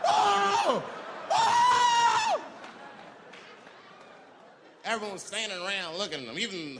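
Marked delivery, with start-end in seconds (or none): 0.1-2.4 very high, very loud shrieks; hammed-up screaming pain. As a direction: shriek as if it burns. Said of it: "This guy was freaking out".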